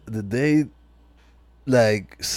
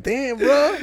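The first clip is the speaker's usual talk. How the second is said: stunned reaction; 11 semitones higher than usual. Retold or imitated. Listener reacting to car hit.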